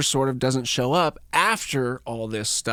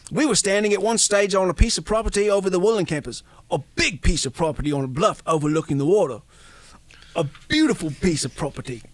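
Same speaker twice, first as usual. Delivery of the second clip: a hint of a London accent; a lightly done London accent; lightly voiced British accent; 4 semitones higher, faster than usual. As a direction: tell it as a cheeky London bloke. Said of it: "It's my terrible German accent".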